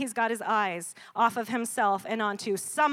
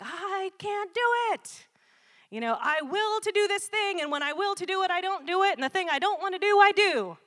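high, louder, exasperated Paul; frustrated Paul; 10 semitones higher, 6 dB louder than usual. Retold or imitated. Preacher voicing Paul in Romans seven, high and exasperated. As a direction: cry out like a man at war with himself. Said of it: "You know, he says, like".

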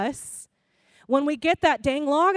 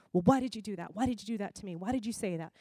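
accusing questions fired in a row; 5 semitones lower, 8 dB quieter, much faster than usual. Shown as a demonstration. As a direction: press "why" like an indictment. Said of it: "feels like an indictment".

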